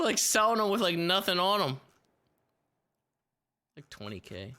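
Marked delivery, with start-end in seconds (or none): none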